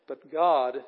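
God punched loud and held; stress on GOD. Shown as a demonstration. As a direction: lean hard on the word God. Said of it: "is the one being emphasized here".

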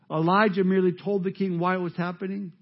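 normal talking voice

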